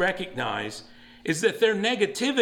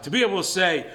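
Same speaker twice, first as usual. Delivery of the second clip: ordinary talk, nothing marked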